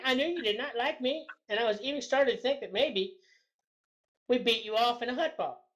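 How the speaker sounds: ordinary talk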